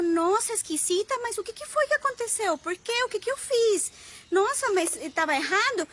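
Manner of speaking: mock panic over the food complaint; shocked worried friend. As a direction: hostess alarmed her dinner was bad, high worried questions.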